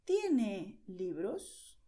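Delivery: very slow, clear question; slow direct question. Shown as a demonstration. Teacher modelling asking for books, very slowly. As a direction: drag out each syllable for learners. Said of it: "the question will be".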